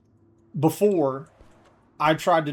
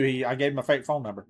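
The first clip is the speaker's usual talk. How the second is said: ordinary talk, nothing marked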